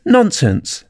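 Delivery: high, decisive interruption; emphatic high-pitched dismissal; loud and decided. A narrator's reading. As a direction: cut in firmly at a high pitch. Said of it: "very loudly and decidedly".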